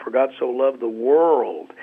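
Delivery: For God so loved the world, with world stressed and falling. Shown as a demonstration. Pastor shows inflection, stressing world last. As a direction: land on the word world. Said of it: "inflect a different word every time".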